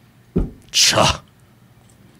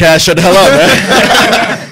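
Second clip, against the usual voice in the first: loud, high shout through laughter; real laughing outburst at a teasing friend; laughing protest; 7 semitones higher, 7 dB louder, much slower than usual. Real, not acted. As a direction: tell a buddy off while cracking up.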